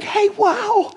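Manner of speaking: very high-pitched fake amazement; mock super-stoked. Preacher pretending the religious leaders were thrilled, mock super-stoked. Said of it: "religious leaders were super stoked".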